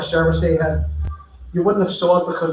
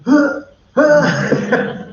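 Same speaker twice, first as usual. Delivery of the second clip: nervous laugh; 9 semitones higher, 4 dB louder than usual. Retold or imitated. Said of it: "And I'm standing there going".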